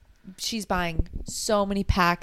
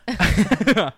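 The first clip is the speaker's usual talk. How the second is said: emphatic echo; 2 semitones higher, 9 dB louder than usual. Real, not acted. Friend gleefully echoing the wife's blunt answer. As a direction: cheer her answer on.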